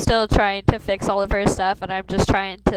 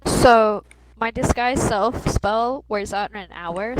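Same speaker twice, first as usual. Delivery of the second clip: normal talking voice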